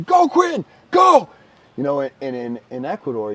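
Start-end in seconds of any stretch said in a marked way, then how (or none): 0.0-1.3 high, loud cheer from the sideline; yelling. Retold or imitated. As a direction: shout it like an excited soccer parent. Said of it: "you know, I'm yelling".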